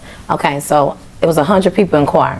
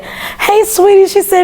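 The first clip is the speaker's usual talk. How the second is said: high, sweet greeting voice, much higher; slower than usual. Retold or imitated. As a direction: imitate a gracious first lady greeting a student.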